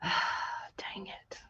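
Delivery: breathy whisper, annoyed at the craft; whispered frustration. Real, not acted. Crafter fumbling with glue, whispered frustration. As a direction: hiss it under your breath.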